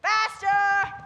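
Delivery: loud command; barked. Acted. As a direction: bark at the crew. Manager hurrying staff.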